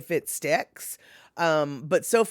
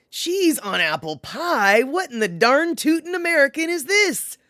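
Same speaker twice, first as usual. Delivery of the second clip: mock outrage; 9 semitones higher, 6 dB louder than usual. Mock outrage over chat comment.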